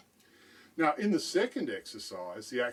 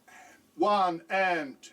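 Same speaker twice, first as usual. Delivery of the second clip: slow, loud, punched count on the accents; counting accented beats loud; 6 semitones higher, 4 dB louder, slower than usual. Shown as a demonstration. Drum teacher counting the written dynamics, accents loud and slow. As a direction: hit each accented count hard and slow. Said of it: "I'd count it like this".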